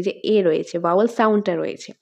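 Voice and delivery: normal talking voice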